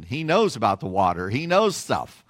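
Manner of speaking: ordinary delivery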